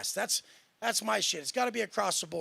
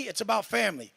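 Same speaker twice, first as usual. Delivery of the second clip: ordinary delivery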